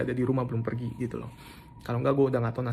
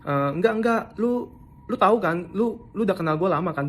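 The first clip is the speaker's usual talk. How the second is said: mock shouted protest, pitched up; retold shouting; 5 semitones higher than usual. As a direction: yell your denial at the neighbour. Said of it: "kayak teriak gitu sambil".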